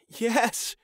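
high, sharp cry of yes; desperate yes. Acted. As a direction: blurt it out in need. Tyon answering.

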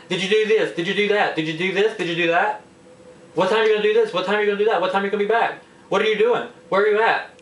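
high-pitched, rapid-fire mock parental questioning; nagging parent friend. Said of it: "so busy being your parent and not your friend".

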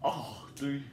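normal talking voice

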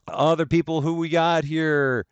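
high, sing-song hellos to each viewer; cheery stream greeting. Real, not acted. Streamer welcoming chat members by name, high and sing-song.

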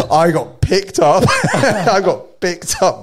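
loud, high, drawn-out words through laughter; laughing exclamation. Real, not acted. Surfer telling friends how the wave took him, laughing exclamation. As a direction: laugh while exclaiming over your own mishap. Laughing as he tells his wipeout.

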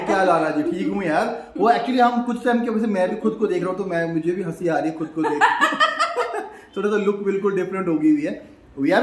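usual speaking voice

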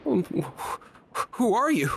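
high, hesitant voice; nervous halfling. Acted. Halfling asking strangers who they are, nervous and high. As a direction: stammer it, high and unsure.

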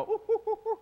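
high ape hoots; playful monkey-call impression. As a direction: hoot like a big ape, way up high. Imitating King Kong.

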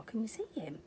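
hushed, playful question; whispered aside. Real, not acted. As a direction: lean in and whisper it. Aside to child listener.

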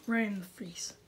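soft breathy whisper; hushed whisper. Real, not acted. Gamer whispering after a jump scare. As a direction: whisper it, still shaken.

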